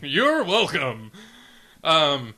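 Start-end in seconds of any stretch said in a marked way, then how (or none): 0.0-1.1 slow, louder host's flourish; game show host. Pretend. Co-host teased as a game show host, stretched-out host voice. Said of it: "Thanks, Chuck Woolery".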